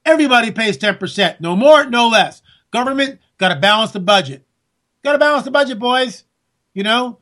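ordinary talk

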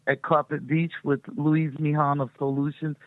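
ordinary delivery

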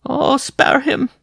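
very high, slow, drawn-out plea; imploring. A narrator's reading. Wife begs mercy for the rider, high imploring cry. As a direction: beg in a loud falsetto wail. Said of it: "Jean-Marie implored".

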